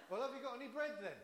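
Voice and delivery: quick, laughing delivery of the punchline; punchline through a laugh. Acted. Preacher delivering duck joke punchline to congregation, laughing.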